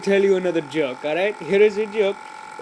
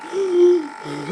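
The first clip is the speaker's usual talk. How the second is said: big theatrical inhale before holding breath; deep gasp for air; 9 semitones higher than usual. Pretend. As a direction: suck in a huge breath, hammed-up. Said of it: "I have to get some air upon me".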